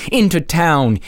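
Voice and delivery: yell. Acted. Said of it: "he yelled".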